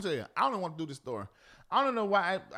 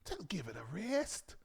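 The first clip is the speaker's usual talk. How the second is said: hushed whisper; 4 semitones lower, 10 dB quieter than usual. Real, not acted. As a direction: drop to a whisper. Sudden hush.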